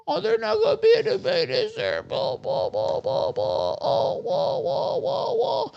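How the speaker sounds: put-on very high, fast squeaky baby whine; crybaby mockery. Streamer parodying viewers as crybabies, squeaky baby whine. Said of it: "I'm gonna cry about it".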